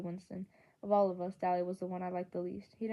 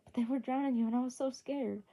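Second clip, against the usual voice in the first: higher, louder pleading rush; blurted out; 5 semitones higher, 4 dB louder than usual. A narrator's reading. Johnny blurts his fear to his friend. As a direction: spill it out in fear. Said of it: "he finally blurted out".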